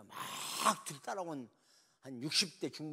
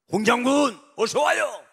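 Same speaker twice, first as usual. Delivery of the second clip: loud, much higher welcome; joyful greeting; 11 semitones higher, 20 dB louder than usual. Acted. President loudly welcoming the general. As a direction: call out gladly to him.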